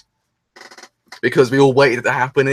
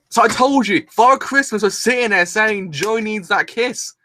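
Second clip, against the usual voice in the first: high-pitched, gleeful I-told-you-so; triumphant excitement; 7 semitones higher than usual. Real, not acted. Fan crowing that the kiss happened, triumphant.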